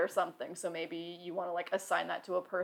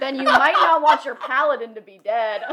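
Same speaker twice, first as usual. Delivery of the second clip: laughing; 4 semitones higher, 10 dB louder than usual. Real, not acted. Laughing at the dead paladin.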